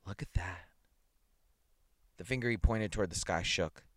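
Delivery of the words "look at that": a frightened hushed whisper; stunned whisper at the sky; dry cracked whisper. A narrator's reading. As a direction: breathe it out, dry and cracked with dread. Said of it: "his voice was dry and cracked".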